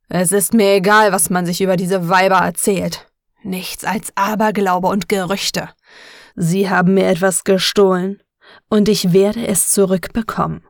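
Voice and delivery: loud furious rant; angry shouting. Acted. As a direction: roar at a cowering employee. Enraged boss rants at employee.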